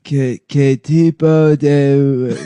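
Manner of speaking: slow, halting Spanish in caricature; Donald Trump. Acted. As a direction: stumble through Spanish as a slow-witted parody.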